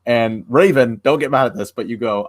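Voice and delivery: usual speaking voice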